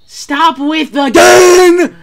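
slow, loud drawn-out yell; exasperated yell. Real, not acted. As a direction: shout at whoever is shooting. Yelled at shooter.

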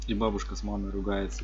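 ordinary talk, nothing marked

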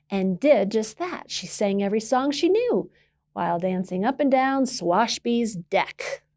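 ordinary delivery, nothing marked

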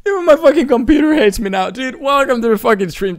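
high, loud, overwhelmed outburst. Real, not acted. Streamer welcoming donor after another huge gift, loud outburst. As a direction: burst out high and loud, then ease down.

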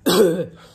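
sharp hacking cough; loud cough. Real, not acted. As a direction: cough hard from the spice. Eating extremely spicy food.

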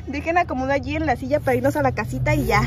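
ordinary talk, nothing marked

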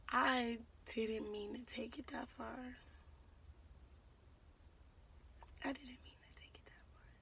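hushed remorseful whisper; whispered regret. Real, not acted. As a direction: breathe the admission quietly. Remorseful repeated aside.

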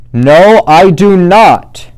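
loud, high storybook shout. Acted. Smelly Bear shouting back at Fluffy Bear, loud. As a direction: yell it back, quarrel at its peak. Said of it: "shouted Big Smelly Bear".

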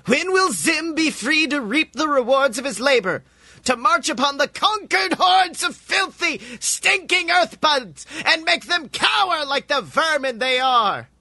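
rally the hordes to conquest; ranting alien. A narrator's reading.

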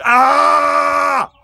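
high, loud scream in a rocket; comic scream at light speed. Retold or imitated. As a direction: let out a wild yell as the rocket launches. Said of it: "sitting in a rocket going".

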